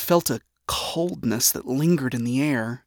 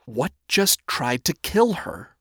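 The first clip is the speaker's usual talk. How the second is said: usual speaking voice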